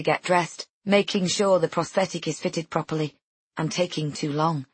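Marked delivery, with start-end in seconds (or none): none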